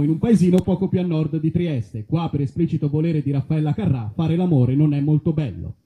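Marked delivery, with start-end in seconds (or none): none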